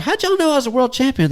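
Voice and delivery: high, incredulous question; mock surprise. Asking the hunting crew, mock surprised. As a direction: act baffled that they knew. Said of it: "I was like".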